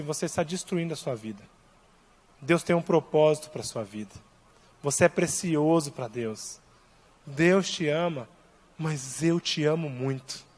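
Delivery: soft, slow and hushed, pleading gently; gentle hushed reassurance; tender plea. Retold or imitated. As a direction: speak softly as if to a broken man. Pastor tenderly addressing homeless man.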